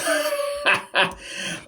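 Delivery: voiceless high laugh; a quick airy chuckle; breathy laugh. Real, not acted. Laugh after elephants.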